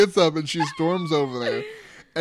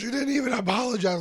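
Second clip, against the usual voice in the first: accusing; 7 semitones higher than usual. Retold or imitated. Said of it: "And she goes".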